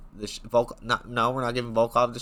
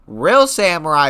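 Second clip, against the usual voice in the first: REAL punched loud and slow; Real samurai belted out; louder emphatic repeat; 3 semitones higher, 8 dB louder, slower than usual. Shown as a demonstration. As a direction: hit Real hard, stretch it out. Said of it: "repeated louder".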